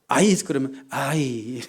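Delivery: drawn-out, reluctant groan of a word; mock dislike. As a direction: drag out 아이 as if you can't stand it. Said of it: "아주 싫은 거죠".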